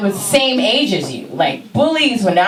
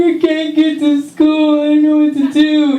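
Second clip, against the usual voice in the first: put-on distressed child near tears; upset kid; 9 semitones higher, 4 dB louder than usual. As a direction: wail that you're lost. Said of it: "I'm like".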